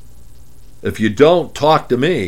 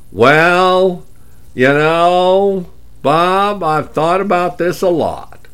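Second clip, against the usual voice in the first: higher-pitched Dallas drawl; 8 semitones higher, slower than usual. Acted. Client pushing back on financial planning, Dallas drawl. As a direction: put on a Dallas caller's drawl. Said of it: "I'd be talking like this".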